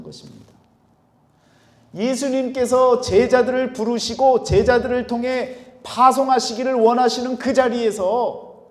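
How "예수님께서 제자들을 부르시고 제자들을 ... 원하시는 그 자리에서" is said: loud, high, sustained sermon delivery; raised preacher's voice, pitched high; impassioned preaching. Real, not acted. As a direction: preach it out with full force. Preacher builds at full force.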